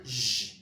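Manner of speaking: J as a drawn-out French "zh"; soft G sound. Shown as a demonstration. Teacher showing how G E sounds, soft "zh". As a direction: say J like the start of "genre". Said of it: "so that G E will".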